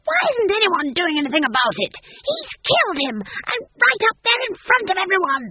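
very high, loud tirade; old witch raging at full pitch; outraged hiss. A narrator's reading. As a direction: protest in shrieking indignation. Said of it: "she hissed".

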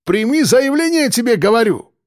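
high-pitched, heated Caucasian man; agitated complainant pressing the duty officer; heated arguing. A narrator's reading. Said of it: "горячо спорил с дежурным".

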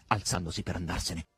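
ordinary delivery

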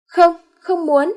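high, slow, loud wail of no; high drawn-out frightened no; terrified refusal. Acted. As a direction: refuse in terror. Maid refuses in terror.

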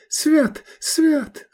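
high, gasping mutter; breathless muttering. A narrator's reading. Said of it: "бормотала она, задыхаясь".

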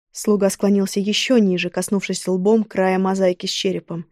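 ordinary delivery, nothing marked

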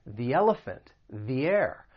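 THE said "thee" before elephant and air. Shown as a demonstration. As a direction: clear ee vowel in THE. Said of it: "comes before a vowel sound".

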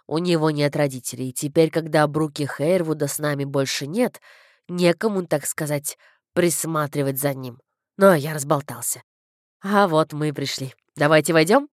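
low-pitched rambling clergyman; concerned, chatty vicar. A narrator's reading. Vicar tells father about orphaned boy, low chatty voice. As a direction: play the vicar well below your own pitch.

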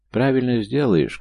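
high, loud, slow shout. A narrator's reading. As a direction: call back over the engine noise. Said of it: "крикнул ему Фёдор".

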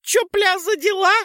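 very high tiny pixie squeak; squeaky pixie. Acted. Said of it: "пропищала Пикси". (писк пикси)

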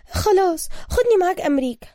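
high, loud, eager female voice; quick, eager woman. A narrator's reading. Woman asking the hero to take her along, eager. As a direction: jump in high with an instant demand. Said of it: "ردت ابتسام بسرعة".

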